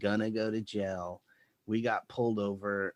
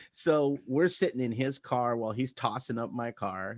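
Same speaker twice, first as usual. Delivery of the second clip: ordinary delivery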